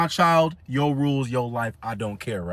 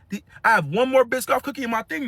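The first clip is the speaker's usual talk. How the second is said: ordinary talk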